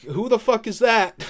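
loud, high-pitched mock outrage; startled demand. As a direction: imitate someone caught totally off guard. Retold startled reaction to stowaway.

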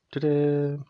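high mock fanfare ta-da; sarcastic ta-da. Ranting about covid, a mocking showman's ta-da. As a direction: sing it out like a sarcastic magic reveal.

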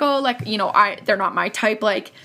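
usual speaking voice

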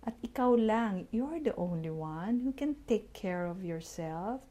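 normal talking voice

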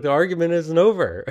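playful mock protest. Calling after his partner as she walks away, playfully indignant. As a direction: call out the protest in play, not mad. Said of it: "and I said it like that".

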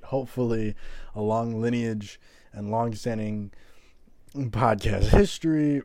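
normal talking voice

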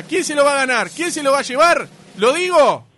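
announcer suspense. Real, not acted. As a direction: keep them waiting for the winner. Host teases the winner.

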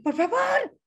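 high, pleading cry; desperate plea. Retold or imitated. Mother begging the nanny on the phone, high desperate plea. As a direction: beg her, voice jumping high. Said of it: "Luego empecé a gritarle, a pedirle de favor".

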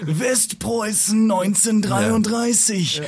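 sehr hoch, sehr langsam und dramatisch; übertriebener Doku-Erzähler mit viel Pathos; Doku-Sprecherstimme. Retold or imitated. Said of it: "diese Dokus gesprochen hat". (parodiert die Stimme der Hitler-Dokus auf N24)

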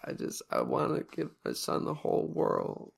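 soft, breathy mock confession to the viewers; mock heartfelt. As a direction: whisper it like a scripted heartfelt vlog. Mocking Cyrax's scripted sincerity.

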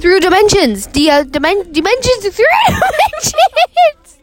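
loud excited eureka; excited realization. Real, not acted. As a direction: burst out with the discovery.

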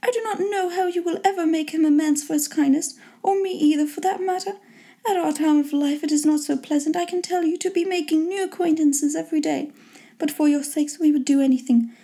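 high-pitched, effusive mother voice; high matronly chatter; fond mother. A narrator's reading. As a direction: voice a pleased mother addressing her girls.

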